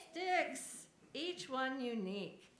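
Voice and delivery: high, loud joyful cry; delighted. Acted. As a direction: exclaim with delight. Joyful exclamation.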